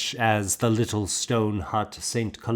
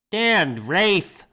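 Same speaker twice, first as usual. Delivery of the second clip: sharp, higher called command; a teacher's clipped order to stand; stern command; 11 semitones higher, 3 dB louder than usual. Acted. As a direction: order the boy to his feet.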